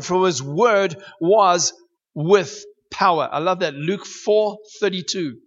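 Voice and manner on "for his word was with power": "for his word was with power" drawn out slow, each word weighted; slow emphatic scripture reading. Acted. Preacher reads Luke to the church, slow and weighty. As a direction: lean on "was" and let "with power" land slowly.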